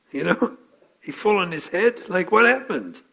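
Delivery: ordinary delivery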